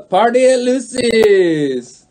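loud, slow, drawn-out holler; mock rallying cry; party call. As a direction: call everyone to a party, stretching the words. Announcing a party to everyone.

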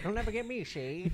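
lightly voiced old-time gangster; a hint of a cartoon mobster; 1930s mobster. Friend joining in the mobster goat bit, light gangster voice. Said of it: "nineteen thirties mobster goat".

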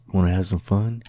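low, hesitant pick-up lines; mock flirting. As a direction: hit on someone, slowing and softening. Mock flirting with the exit sign.

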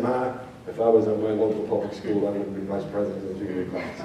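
low, soft daughter comeback; quiet pointed retort. Retold or imitated. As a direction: deliver the punchline quietly.

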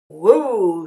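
loud startled whoa; rancher's shout. A narrator's reading. Rancher shouting after the escaping cowboy, loud. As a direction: yell at the runaway cookie. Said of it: "shouted the rancher".

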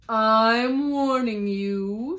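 loud, long-drawn royal shout; shouted warning. A narrator's reading. The Queen threatens the Duchess, shouting. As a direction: bellow it slowly, stretching each word. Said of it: "shouted the Queen".

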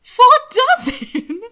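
high, slow, laughing squeak; squeaked in surprise. A narrator's reading. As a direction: squeal it in shocked disbelief. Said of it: "Will squeaked".

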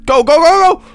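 high, loud, urgent repeats; frantic urging. Real, not acted. Player rushing out of the water, frantic urging. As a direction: push each go out urgently.

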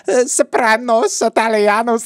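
put-on high, clownish Italian accent; cartoonish Italian accent. Mocking [name]'s cartoonish Italian accent in an argument. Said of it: "without doing a cartoonish accent".

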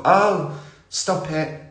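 a mock coy "Oh, stop it"; coy fluster. Blushing at the flirting men, coy. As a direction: play it bashful and flattered. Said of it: "I'd be blushing".

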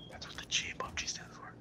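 unvoiced whispered aside; whispering. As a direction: whisper the joke behind a hand.

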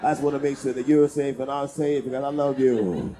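low, monotone pillow-talk line; mock responsible lover. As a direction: play the considerate lover, very low. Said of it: "then back up and say".

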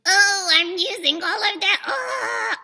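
loud, pinched, straining delivery; overdone throaty strain. Shown as a demonstration. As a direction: show the wrong way, forcing it from the throat. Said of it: "I'm not just going".

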